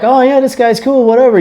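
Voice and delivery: high, casual mock voice; voicing someone warming up to a stranger, high and casual; imitating a new guy. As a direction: lightly act out the easygoing reply. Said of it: "then you're like".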